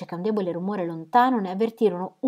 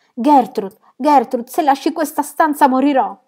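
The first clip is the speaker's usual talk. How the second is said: loud, high cry of panic; desperate plea; 8 semitones higher, 11 dB louder than usual. A narrator's reading. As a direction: call the name twice, then wail the threat, rising. Terrified Fanny begs Gertrude to stay.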